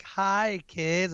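acted-out upbeat dad hello; cheery greeting. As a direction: greet the children brightly, playing it up. Cheerful greeting before patience runs out.

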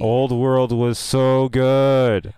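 loud, slow, drawn-out praise; gushing. Acted. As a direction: stretch it out, savouring the memory. Gushing about a favourite café.

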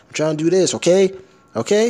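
high, loud "okay?" rising each time; mock the overused "okay?" with a climbing questioning pitch; "okay?" pushed up high and insistent. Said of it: "don't say okay like that".

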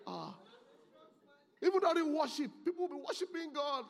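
high, loud shouted exhortation; full-force preaching. Real, not acted. Preacher rebuking men who never shed a tear, shouting. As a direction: shout it out to the whole congregation.

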